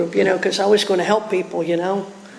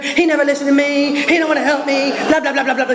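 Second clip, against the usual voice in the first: mock a self-pitying moaner, pitched up; 6 semitones higher, faster than usual. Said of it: "Self-pity oozing from every single pore".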